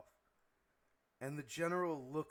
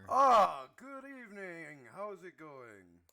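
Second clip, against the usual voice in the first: lightly voiced captain, starting high on a drawn-out greeting; ship captain; 5 semitones higher, 8 dB louder, slower than usual. Captain greeting newcomers aboard, welcoming. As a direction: open high, then ease down like a welcoming host.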